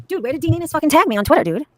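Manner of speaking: ordinary talk, nothing marked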